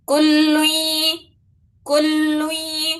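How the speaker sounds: slow chanted کل لوئی with the nasal نون held; recited with ghunna. Shown as a demonstration. Teacher recites for students, slow nasal ghunna. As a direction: recite کل لوئی slowly, nasal sound held two beats. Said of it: "لوئی پڑھنا ہے اس کو".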